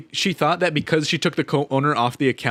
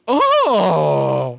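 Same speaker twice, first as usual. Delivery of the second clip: mock surprise; 2 semitones higher, 5 dB louder than usual. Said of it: "and I'm like".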